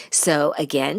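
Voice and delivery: ordinary talk, nothing marked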